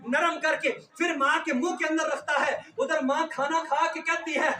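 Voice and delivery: normal talking voice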